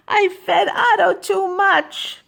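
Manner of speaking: put-on high-pitched child's confession; distressed boy. Boy confesses to Mister Carp.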